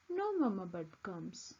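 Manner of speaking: ordinary delivery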